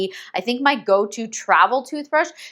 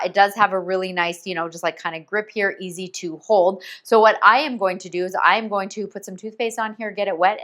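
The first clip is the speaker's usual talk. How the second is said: ordinary delivery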